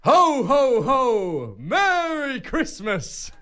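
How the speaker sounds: slow stretched Santa greeting. Acted. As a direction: draw out a jolly Merry Christmas. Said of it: "Come on".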